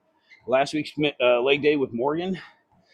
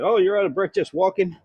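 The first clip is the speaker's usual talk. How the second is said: higher, sneering imitation of a critic; mocking quote; 7 semitones higher, 3 dB louder than usual. Vlogger mocking a stupid comment from viewers, sneering higher. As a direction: mimic the snide commenter, pitched up. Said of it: "That was also one of the stupid comments".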